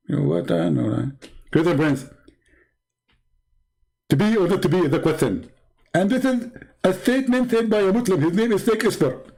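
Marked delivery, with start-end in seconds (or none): 1.5-9.3 Zakir Naik impression. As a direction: play the smug preacher lecturing his caller. Same voice.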